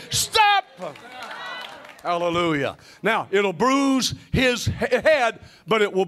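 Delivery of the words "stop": high, explosive preacher's shout; shouted command. Real, not acted. Preacher cueing the church to say it, shouted command. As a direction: cry it out high as a rallying word. Cues congregation to shout along.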